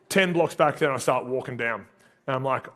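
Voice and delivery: ordinary talk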